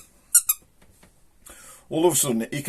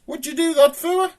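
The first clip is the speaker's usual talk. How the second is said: very high, loud indignant squeak; aggrieved high-pitched creature; little snail voice; 7 dB louder than usual. Acted. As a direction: protest as a wronged tiny snail. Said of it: "he hears this little voice".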